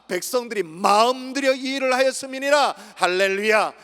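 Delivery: high, loud pulpit exposition; full-force preaching. Real, not acted. Preacher explains the result of prayer, forceful preaching. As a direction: build to a ringing hallelujah.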